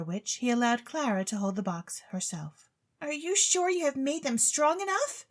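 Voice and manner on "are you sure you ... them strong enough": put-on high, worried young lady asking; anxious question. Claribel anxiously asks the chemist about the bonbons, high and worried. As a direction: fret that the magic is too weak. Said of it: "she inquired anxiously".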